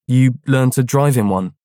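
loud retort. Acted. His comeback to Clara.

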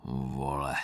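low, hushed insult; quiet aside. A narrator's reading. Recruit behind the narrator about the sergeant, quiet aside. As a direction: say it low and soft under your breath. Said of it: "potichu říká".

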